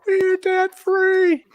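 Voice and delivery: high, loud, slow drawn-out scream; debt-free scream. Retold or imitated. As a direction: scream it like you just paid everything off. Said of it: "debt-free scream".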